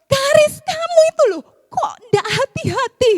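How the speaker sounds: high, loud mock scolding; grumbling at child. Mother scolding daughter over broken item, high loud mock scold. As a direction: snap at the child like a frazzled mom. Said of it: "Sungut saya langsung".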